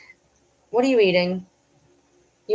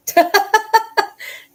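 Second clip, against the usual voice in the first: high burst of laughter; 5 dB louder than usual. Real, not acted. Laughs at dog.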